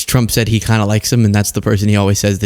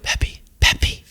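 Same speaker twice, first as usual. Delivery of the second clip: whispered prompt, voiceless; Susie Wiles; 4 dB quieter, much slower than usual. Retold or imitated. Commentator imitating an aide prompting the president, whispered. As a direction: whisper the name as a discreet reminder. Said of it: "Susie Wiles being like".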